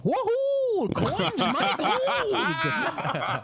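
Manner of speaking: put-on high, wild old-timer whoop; old prospector. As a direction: whoop like a gold-crazed prospector. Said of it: "Just put an old prospector in any movie".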